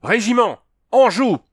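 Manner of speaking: high military shout; shouted command. A narrator's reading. Andrew calls his regiment to aim, shouting. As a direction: bellow to the whole regiment to take aim. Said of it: "cria Andrew".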